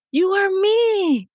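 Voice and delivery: slow, high, insistent on "you are me"; emphatic reveal. Retold or imitated. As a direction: land each word of "you are me". Revealing herself to younger self.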